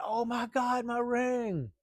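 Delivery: high-pitched imitation of a panicked scream; retold scream. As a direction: wail it high, acting out her scream without full volume. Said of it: "Linda just screamed".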